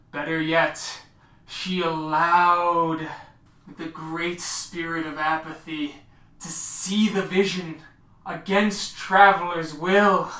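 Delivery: loud, drawn-out ceremonial oration; proclaiming Galliard. Acted. Galliard announces newcomer's deeds to the pack, loud proclamation. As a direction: proclaim the deeds to the whole pack, hitting key words hard. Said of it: "Galliard who will speak of your accomplishment".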